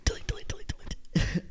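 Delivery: secretive whisper; hushed repeated chant. Shown as a demonstration. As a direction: whisper it over and over, barely voiced. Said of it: "I don't know why you whisper it".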